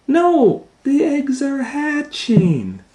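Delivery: very high, drawn-out news; excited announcement. Acted. Piggy correcting Elephant about the eggs, high excited announcement.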